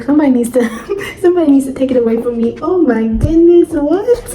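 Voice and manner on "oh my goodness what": surprised. Real, not acted. Reader stunned by a plot turn, surprised exclamation. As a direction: caught off guard by the page.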